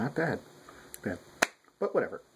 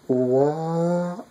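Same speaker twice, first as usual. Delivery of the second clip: shocked what; 6 semitones higher than usual. Retold or imitated. Own shocked reaction retold.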